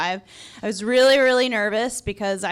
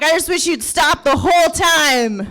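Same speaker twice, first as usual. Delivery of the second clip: put-on loud, high drunken complaint; drunken rant; 7 semitones higher, 7 dB louder than usual. Drunk patient to tapping technician, loud exasperated rant. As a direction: plead in drunken exasperation, loudly. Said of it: "I sound drunk and I feel drunk".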